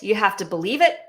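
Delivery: ordinary talk, nothing marked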